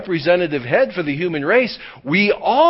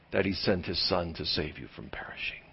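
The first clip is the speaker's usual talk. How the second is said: hushed, breathy whisper, much softer; 11 semitones lower, 14 dB quieter than usual. Acted. Preacher confiding the heart of Christmas to the congregation, whispered. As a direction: lean in and whisper the good news. Sermon climax, voice drops.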